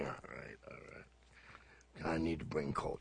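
reluctant mumble. Retold or imitated. Retold reluctant phone reply.

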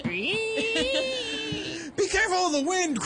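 high, slow, drawn-out warning; old-time radio drama. Pretend. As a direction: stretch it out like a radio serial. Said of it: "This is like nineteen forties".

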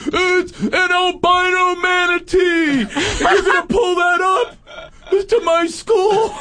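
put-on high, loud, slow teen wail; freaking-out teen. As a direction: wail it like the end of the world. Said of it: "freaking out".

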